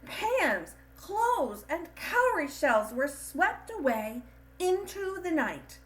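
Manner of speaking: lively storyteller voice; storybook reading. A narrator's reading. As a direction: read the ending like a bedtime story. Closing lines of a read-aloud story.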